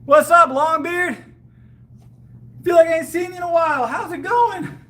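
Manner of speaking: excited greeting. Real, not acted. Greeting chat viewer excitedly.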